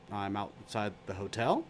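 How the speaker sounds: low, soft, slow; calm Matt. Retold or imitated. Matt on the phone to Patrick, low and slow. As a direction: answer totally unbothered. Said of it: "He goes".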